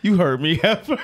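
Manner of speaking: loud laughing insult; laughing taunt. Retold or imitated. Prankster insulting woman on call, loud laughing taunt. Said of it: "he goes".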